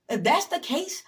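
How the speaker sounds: escalating emphatic repeat. Real, not acted. Repeat rises higher and louder.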